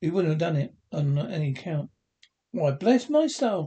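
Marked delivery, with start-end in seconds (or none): 2.5-3.7 slow, higher exclamation; surprised cry. A narrator's reading. Fred startled by his uncle, slower higher cry. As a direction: gasp it out in amazement. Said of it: "cried Fred".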